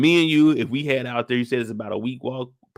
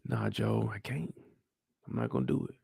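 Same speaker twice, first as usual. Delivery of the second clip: whispered, softer refusal; hushed no to his brother; quiet refusal; 10 dB quieter than usual. Acted. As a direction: let him down gently under your breath. Tommy refuses again quietly.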